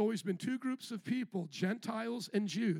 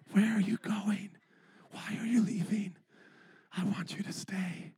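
mock whisper. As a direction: pretend to whisper after leavers. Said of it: "while I'm whispering".

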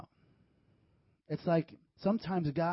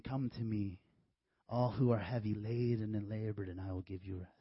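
gentle Christ; 6 semitones lower, 4 dB quieter than usual. Retold or imitated. Christ invites the weary.

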